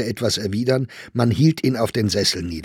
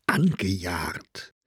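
slow, low, drawn-out repetition; brooding on a mockery; 3 semitones lower, 3 dB quieter, much slower than usual. A narrator's reading. Old poet broods over a mocking word, slow and hurt. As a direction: dwell heavily on ANGEJAGT. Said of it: "Das war spöttisch gesagt".

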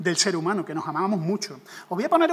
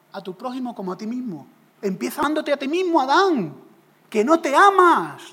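tempter's voice. Acted. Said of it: "le dijo el tentador". (el tentador insistiendo a Adán, cada vez más alto)

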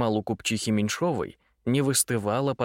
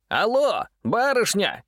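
loud, high shout into a phone; 10 semitones higher, 4 dB louder, slower than usual. A narrator's reading. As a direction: yell it down the line. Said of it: "заорал Митя в трубку".

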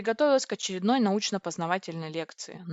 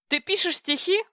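much higher, thrilled squeal; gleeful high-pitched question; delighted squeal; 11 semitones higher, 4 dB louder than usual. A narrator's reading. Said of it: "взвизгнула я от восторга".

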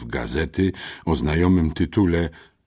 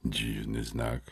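a low, much softer mutter; 5 semitones lower, 10 dB quieter, slower than usual. Acted. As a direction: mumble it half to yourself, puzzled. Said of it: "mruknąłem". (cichy pomruk pod nosem)

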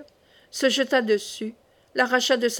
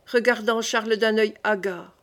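ordinary delivery, nothing marked

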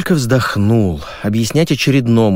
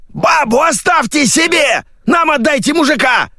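very high-pitched screech; squealed. A narrator's reading. Radio voice demands the man be sent, squealing. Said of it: "взвизгнули из переговорного устройства".

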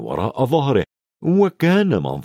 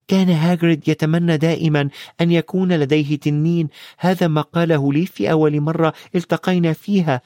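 put-on higher-pitched young hero, explaining; lightly voiced boy Harry; 6 semitones higher than usual. Harry recalls Hagrid's dragon wish, lightly voiced higher boy. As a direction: recall it in a lightly raised boy's voice.

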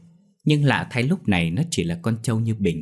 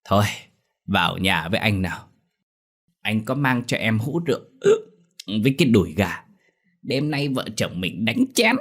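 put-on drunk slur. A narrator's reading. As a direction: slur it, tipsy and pleased. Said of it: "giọng lè nhè".

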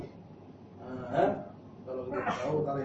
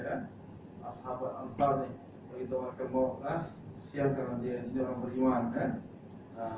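usual speaking voice

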